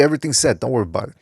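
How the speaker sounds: normal talking voice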